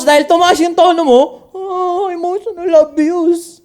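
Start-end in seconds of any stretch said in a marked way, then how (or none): 1.5-3.6 high, slow, drawn-out mock whine; mock complaint. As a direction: imitate an aggrieved partner, high and slow. Mimics partner's complaint about raised tone. (pabirong pag-iinarte, mataas at mabagal)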